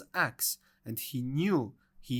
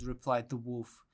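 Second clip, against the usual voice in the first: plain reading voice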